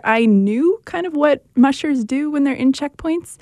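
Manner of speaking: ordinary talk, nothing marked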